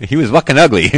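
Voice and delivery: loud, laughing blurt; laughing outburst. Real, not acted. Finally insulting the dead president, loud and laughing. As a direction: burst out with it through a laugh. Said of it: "how am I gonna speak bad of the dead".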